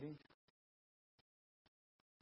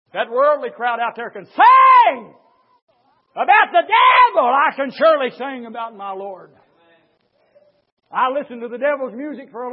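loud, high shouted preaching; fiery preaching; 6 semitones higher, 17 dB louder than usual. Acted. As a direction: thunder it from the pulpit. Preaching at full force.

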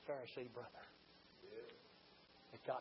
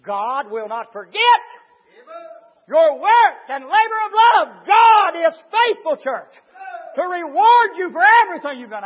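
preaching at full force; 12 semitones higher, 20 dB louder than usual. Acted. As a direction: thunder the promise to the church. Preacher erupts again.

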